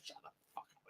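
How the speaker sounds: voiceless whisper, barely audible; very soft whispered dismissal; mock hushed retort. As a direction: whisper the retort under your breath.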